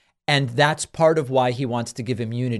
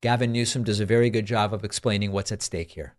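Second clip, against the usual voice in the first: ordinary delivery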